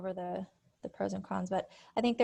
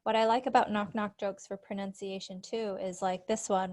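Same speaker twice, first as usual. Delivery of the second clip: normal talking voice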